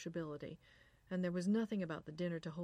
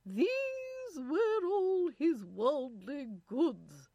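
loud, high, very slow British recitation; 8 semitones higher, 9 dB louder, much slower than usual. A narrator's reading. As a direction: draw out the verse in grand British tones. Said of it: "the British voice continued".

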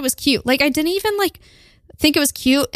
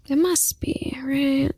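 whispering; 2 semitones higher, 5 dB quieter, slower than usual. Real, not acted. Wistful aside about the lost couch.

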